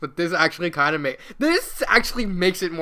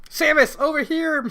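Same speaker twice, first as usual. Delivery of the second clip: loud, higher yelp for help; yipping call; 10 semitones higher, 5 dB louder than usual. Acted. Mega Man calls Samus to find him, yipping. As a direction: yip out to be found. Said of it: "Mega Man yipped".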